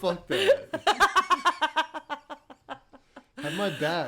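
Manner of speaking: high, loud laughing. Real, not acted. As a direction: protest while laughing. Laughing protest.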